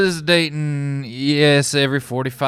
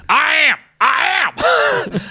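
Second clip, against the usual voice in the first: pitched way up, insisting; squeaky high insistence; 11 semitones higher, much slower than usual. Acted. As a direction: squeak it out higher each time. [name] squeaks in character.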